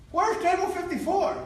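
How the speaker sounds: high-pitched, normal-volume question; retold lost guest's question; polite query. Asking a stranger for help.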